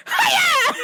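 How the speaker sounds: high loud karate cry; karate yell. Retold or imitated. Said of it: "on the intercom go".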